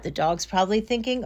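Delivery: usual speaking voice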